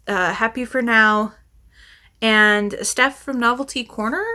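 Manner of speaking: usual speaking voice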